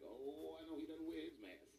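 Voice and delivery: put-on lightly done rural Ohio twang accent; country twang accent. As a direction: mimic a small-town Ohio twang accent. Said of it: "that twang where you hear that accent like".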